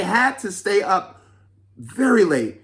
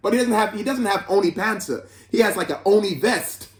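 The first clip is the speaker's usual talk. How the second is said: laughing; 4 semitones higher than usual. Real, not acted. Laughing at the picture.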